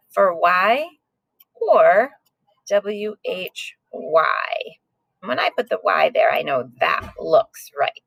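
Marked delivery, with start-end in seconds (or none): 2.7-4.5 spelling out "W H Y" very slowly, letter by letter; slow spelled-out letters. Shown as a demonstration. Teacher spelling "W H Y" slowly for her class. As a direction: drag out "W H Y" one letter at a time. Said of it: "check it out".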